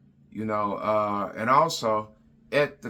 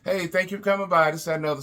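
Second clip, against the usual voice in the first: fake-friendly brush-off; 6 semitones higher, much faster than usual. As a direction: hurry them out the door with a smile. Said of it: "and be".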